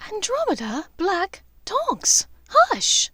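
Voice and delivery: rising to a high loud hush; scandalised hush. Acted. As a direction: shush her, mortified. Draco scolds aunt.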